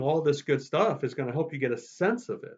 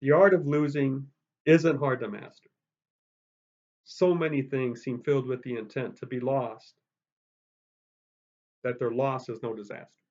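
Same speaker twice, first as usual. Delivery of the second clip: unhurried reading with big pause before "that their loss"; natural poem reading. Shown as a demonstration. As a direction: let the poem breathe. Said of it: "You don't read poetry that way".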